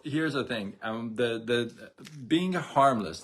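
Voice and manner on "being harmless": "being harmless" said slower and louder; stressed key phrase. Real, not acted. Host stressing to live viewers the point about "being harmless", slow and emphatic. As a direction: lean hard on "being harmless", drawing it out. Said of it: "I wanna stress this".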